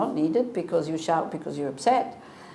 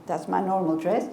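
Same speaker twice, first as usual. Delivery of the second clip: ordinary delivery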